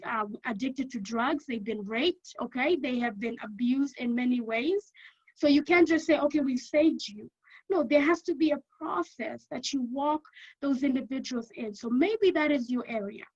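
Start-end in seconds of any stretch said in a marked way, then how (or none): none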